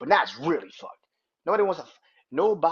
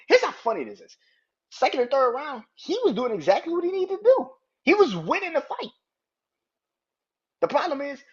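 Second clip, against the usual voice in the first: heated, excited rant; 8 semitones higher, 6 dB louder than usual. Real, not acted. Heated breakdown of the fight.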